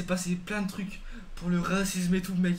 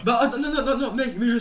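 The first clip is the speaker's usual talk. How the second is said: higher, louder protest through laughter; laughing protest; 4 semitones higher, 6 dB louder than usual. Real, not acted. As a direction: push the idea away laughing. Refuse de manger son vomi. (refus horrifié en riant)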